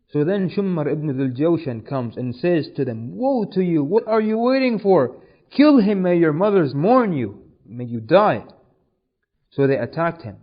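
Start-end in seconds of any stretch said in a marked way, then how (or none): 3.2-8.5 loud, raised, berating order; impassioned rebuke from a storyteller; retold angry command. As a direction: goad hesitant soldiers into attacking. Shumar berates the hesitant soldiers.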